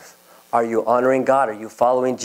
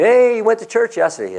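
higher, mocking coworker; teasing coworker; 10 semitones higher than usual. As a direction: tease it out higher.

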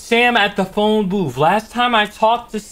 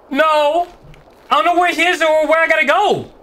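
panicked; 8 semitones higher than usual. Real, not acted. Panicking while chased.

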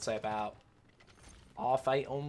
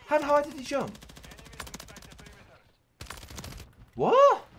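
very high, loud disbelief; incredulous squawking; 7 dB louder than usual. Real, not acted. Player baffled by an enemy's jump, high incredulous yelling. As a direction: shriek the questions in astonishment.

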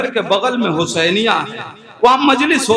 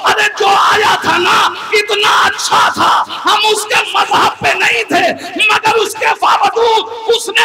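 lamenting sermon climax; 9 semitones higher than usual. Acted. Preacher voicing the daughter's lament for Karbala, in a high tearful shout. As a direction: cry out the grief of Karbala to the mourners.

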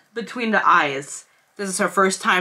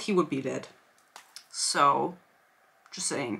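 quiet aside; 6 semitones lower, 8 dB quieter than usual. Real, not acted. Dry aside after reading.

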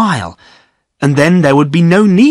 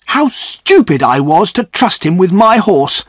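angry self-reproach; 6 semitones higher than usual. Acted. Godfrey blames himself for trusting his brother, angry. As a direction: curse his own foolishness. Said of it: "replied Godfrey angrily".

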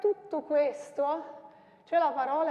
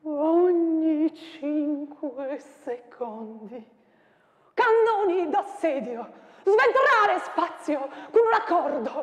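declaimed with wild swings of pitch and loudness; dramatic poem recital; 7 semitones higher, 9 dB louder, slower than usual. Acted. Teacher performing the battle poem for students, declaimed.

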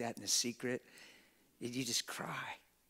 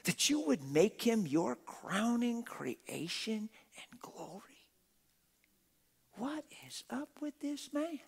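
awed whisper; 9 semitones higher than usual. Acted. Psalm building to awe.

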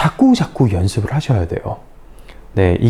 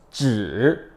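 쯔 low dip, then up; third tone; 3 semitones lower, 6 dB quieter than usual. Shown as a demonstration. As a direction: sink 쯔 low and let it rise. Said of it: "삼성".

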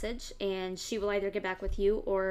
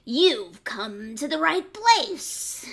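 louder, slow gloating; gloating fox; 6 semitones higher, 10 dB louder, much slower than usual. A narrator's reading. Fox gloating to the piglet. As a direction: savor every word. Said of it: "cried the fox".

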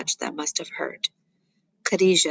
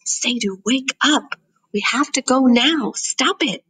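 higher-pitched, insistent order; acted urgency, pitched up; urgent; 6 semitones higher than usual. A narrator's reading. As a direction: press a struggling child to get moving. Urgently rousing a struggling brother.

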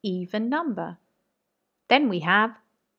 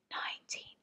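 whispered counting for pupils; 19 dB quieter than usual. Shown as a demonstration. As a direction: whisper the number softly. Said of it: "whisper the ones".

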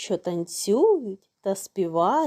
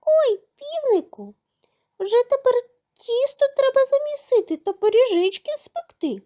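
very high, excited little mouse; gleeful piping storybook mouse; delighted mouse; 13 semitones higher, 3 dB louder than usual. A narrator's reading. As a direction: squeal it happily like a tiny mouse. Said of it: "пораділи".

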